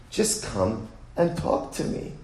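slow whispered "just come and talk to me"; whispered plea. Acted. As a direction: breathe the plea softly. Soft unvoiced plea.

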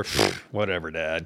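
low, offhand brush-off, imitated; dismissive teen. Boys brushing off a parent's teaching, dismissive.

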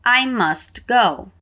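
slow, careful model read; slow repeat. Shown as a demonstration. Teacher modelling the phrase slowly for students. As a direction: say it slowly so they can repeat. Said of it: "Let's repeat".

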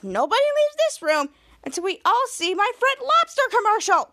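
high-pitched, insistent demand; bossy mock order. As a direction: act out laying down the law. Acted bossy order to everyone.

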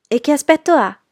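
put-on high, loud, excited young ladies; loud, high-pitched eager curiosity; eager chorus question. A narrator's reading. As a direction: burst out with the question in one breath. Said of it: "domandarono le signorine Ashton a un fiato".

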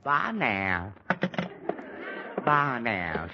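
a hint of sarcastic parrot; mimicking Veronica. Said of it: "she says".

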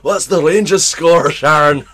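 high, loud, slow [name] yell; [name] shouting to Sharon. Retold or imitated. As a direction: bellow across the house to Sharon. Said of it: "He's more".

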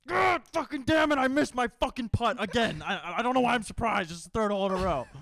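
Dylan furious. Retold or imitated. Friend mocks Dylan raging after missing a putt, shouting. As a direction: rage at a missed putt at full volume. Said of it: "do your best Dylan impression".

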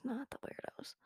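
very soft whisper; whispered aside. Real, not acted. Host adding a caveat for the girls, whispered aside. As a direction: drop to a hushed, confiding whisper.

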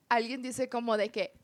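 usual speaking voice

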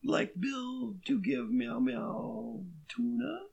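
high, slow timid cat voice; nervous cat voice. Pretend. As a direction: plead like a skittish housecat. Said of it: "I thought you just went meow".

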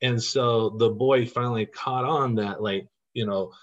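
ordinary delivery

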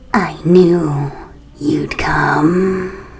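low, eerie rasping whisper; raspy ghost whisper. Acted. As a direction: breathe it like a corpse. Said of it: "In her raspy voice".

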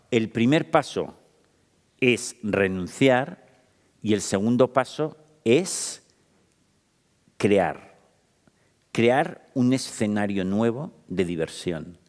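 muy lento, palabra a palabra; slow emphasis. Shown as a demonstration. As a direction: subraya cada paso sin prisa. Explica los pasos despacio. (enumerando los pasos, despacio y marcado)

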